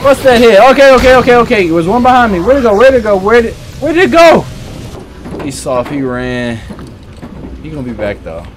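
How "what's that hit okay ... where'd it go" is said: panicked. Real, not acted. Panicked searching for monster.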